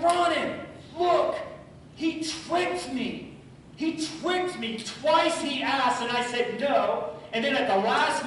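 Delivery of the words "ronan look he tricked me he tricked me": high, urgent retelling of being tricked; pleading to his wife that the boy fooled him; indignant complaint. Retold or imitated. Upset husband telling his wife he was tricked.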